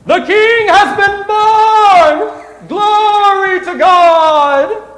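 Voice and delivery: high, loud, slow proclaiming; joyful proclamation. Acted. Storyteller shouting the angels' praise to the room.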